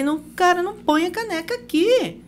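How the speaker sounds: high-pitched exasperated scolding. Acted. Repeating the mug rule for the thousandth time, exasperated. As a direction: scold at the end of your patience. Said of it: "É a milésima vez que eu falei".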